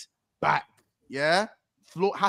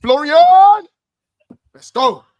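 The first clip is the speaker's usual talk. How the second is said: cheering; 11 semitones higher, 14 dB louder than usual. Real, not acted. Cheering the signing.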